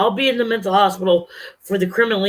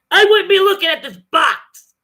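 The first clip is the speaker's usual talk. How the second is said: much higher, voice cracking into a real cry; breaking into tears; 11 semitones higher, 4 dB louder than usual. Real, not acted. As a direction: let the words crumble into sobs. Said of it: "I don't wanna cry on camera".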